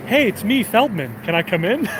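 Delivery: high, singsong hello into the intercom; courteous, lilting caller's voice; polite Feldman calling up. Retold or imitated. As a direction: call up sweetly, asking to be let in. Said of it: "he'll call up to Jerry".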